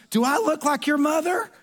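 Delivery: high-pitched, indignant scolding question; mock-stern reprimand, high and pointed; scolding manager. Stern manager scolds messy customer.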